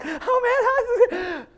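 bubbly, laughing greeting; cheerful greeter. Retold or imitated. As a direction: chirp it like a happy friend. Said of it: "someone was basically happy".

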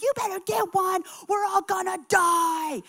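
high, frantic shouted demand; mock shriek of a panicking guest; going off. Guest demanding a room from desk gal, frantic. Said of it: "going off on this front desk gal".

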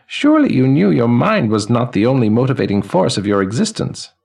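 put-on high, ringing elderly man's voice; chiming old man. Elderly man greeting the newly dead, high and chiming. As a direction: chime each word like silver cymbals and laughter.

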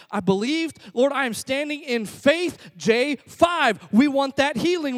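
high, loud, insistent pleading; frustrated prayer. Acted. Believer insists God grant healing, frustrated. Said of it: "we get frustrated a lot".

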